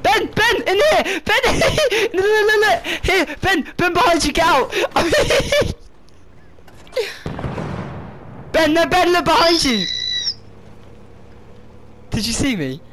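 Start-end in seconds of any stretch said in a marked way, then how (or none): 0.0-9.8 high, laughing urgent shouts; yelling with laughter. Real, not acted. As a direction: call out to warn him, laughing. Calling teammate into her hiding bush.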